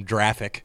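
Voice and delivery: graphic said "jraphic"; soft g in graphic, as a joke; mock soft g. Shown as a demonstration. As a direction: say graphic with a j sound at the start. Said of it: "pronounce gif gif".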